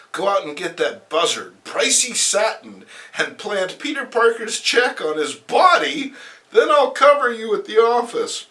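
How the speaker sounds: loud high villainess plotting; villainess giving orders. Acted. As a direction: bark orders at your henchwomen. Voices Felicity giving orders.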